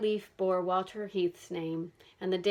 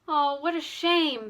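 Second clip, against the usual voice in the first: slow, higher, louder lament; wailing; 7 semitones higher, 5 dB louder, slower than usual. A narrator's reading. Bess wails over the diary ending with a slow lament. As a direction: draw out the disappointment. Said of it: "Bess wailed".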